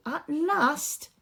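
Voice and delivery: ordinary delivery, nothing marked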